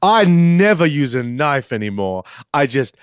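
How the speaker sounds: theatrical, mock-refined monologue; hammed-up socialite. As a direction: relish it like a decadent socialite. Socialite character bit.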